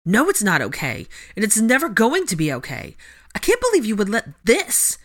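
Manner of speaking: heated, rising indignation; spitting anger. A narrator's reading. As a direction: rail at a friend in disbelief. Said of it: "she spit out".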